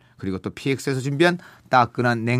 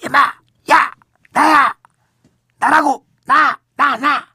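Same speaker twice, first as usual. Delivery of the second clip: whisper yelling; 13 semitones higher, 8 dB louder, much slower than usual. Acted. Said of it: "낮고 작은 목소리로 소리쳤습니다".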